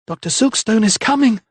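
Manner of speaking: high, breathless cry of warning; panting. A narrator's reading. Mother panting at the vicar to stay back. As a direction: gasp it out while shielding her child. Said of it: "she panted".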